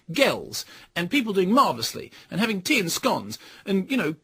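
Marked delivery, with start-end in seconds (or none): none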